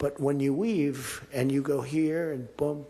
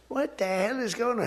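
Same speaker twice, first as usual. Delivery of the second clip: mock outraged friend; 8 semitones higher than usual. Rich friend calls, upset.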